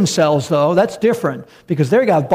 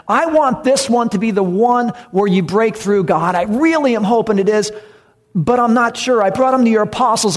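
acted desperate plea, pitched up in surges; crying out; 3 semitones higher than usual. Father begging God to help his boy, acted desperate cry. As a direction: plead in anguish, pushing the stressed words higher. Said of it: "he's, he's crying".

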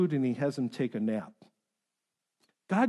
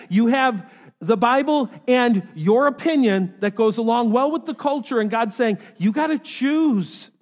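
loud, raised-pitch exhortation; forceful preaching; 6 semitones higher, 8 dB louder than usual. Real, not acted. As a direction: preach hard at the congregation, driving each point.

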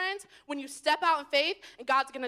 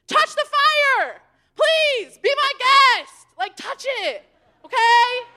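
much higher, louder, slow taunt; mock daring voice; 7 semitones higher, 8 dB louder, much slower than usual. The despairing voice daring them, high and taunting.

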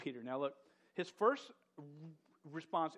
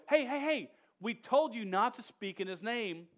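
loud, much higher angry shout; 7 semitones higher, 9 dB louder than usual. Acted. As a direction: yell at them, fed up. Council scolds apostles again.

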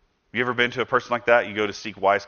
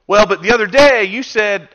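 defensive retort; 10 semitones higher, 9 dB louder than usual. Retold or imitated. Acted defensive comeback to critique.

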